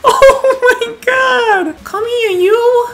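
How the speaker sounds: high, slow, gushing squeal; squealing over cute. Real, not acted. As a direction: coo at something adorable. Tiny cute villager appears.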